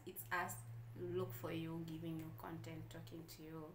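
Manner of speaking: ordinary talk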